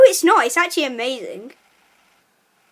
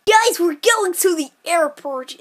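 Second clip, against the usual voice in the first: odd put-on voice; 3 semitones higher than usual. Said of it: "I don't know why I talk like that".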